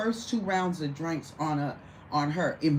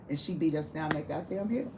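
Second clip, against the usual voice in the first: ordinary delivery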